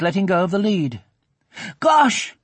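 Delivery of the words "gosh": put-on high, loud boy's apology; high-pitched, urgent boyish exclamation; alarmed cry. A narrator's reading. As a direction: cry out a startled sorry. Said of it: "the kicker cried".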